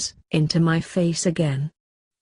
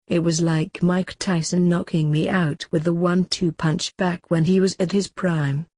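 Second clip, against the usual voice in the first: plain reading voice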